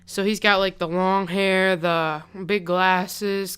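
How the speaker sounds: ordinary talk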